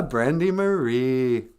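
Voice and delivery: ordinary talk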